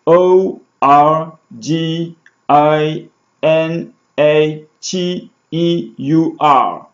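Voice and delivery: letter by letter, slow dictation; spelled out slowly. Shown as a demonstration. Teacher spelling a word slowly for students. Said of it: "I spell it for you".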